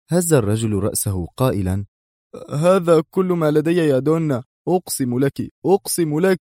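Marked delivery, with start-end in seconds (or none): 2.5-6.4 high, anxious insistence; frightened plea climbing higher; desperate pleading. A narrator's reading. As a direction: swear it as if begging for your life. Frightened man swears to boss.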